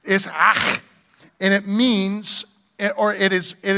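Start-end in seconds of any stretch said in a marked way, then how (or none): 0.3-0.8 "ah" with a spitty throat-scraped ending; "ah" finished with a raspy Hebrew guttural; Hebrew pronunciation. Shown as a demonstration. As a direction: scrape out a long ch after "ah". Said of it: "You might spit on him".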